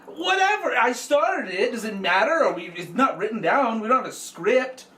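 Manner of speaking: high, loud fake fury; mock temper tantrum. Host blows up at co-host over the intro, mock furious rant. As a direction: rant like a diva who lost his take. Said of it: "sorry about his little temper tantrum".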